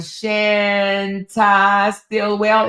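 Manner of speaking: "Shanta Stillwell" drawn out slowly, syllable by syllable; careful name. Shown as a demonstration. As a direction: sound out an unfamiliar name slowly. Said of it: "Is that how you say it?".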